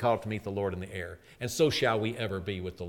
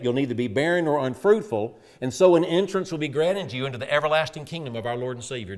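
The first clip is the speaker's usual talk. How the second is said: louder, ringing pulpit exhortation; full-force preaching; 3 semitones higher, 5 dB louder than usual. Acted. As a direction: preach it out to the whole church.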